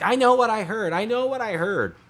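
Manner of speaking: high, indignant mock-mom voice; imitated mom standing her ground, pitched high; imitating insistent mom. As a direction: insist like a mother nobody believes. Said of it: "And she's like".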